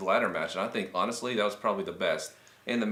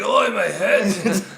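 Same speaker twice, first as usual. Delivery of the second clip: slow, high laughing mock voice; laughing imitation; 8 semitones higher, 6 dB louder, much slower than usual. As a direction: giggle through a mock groan.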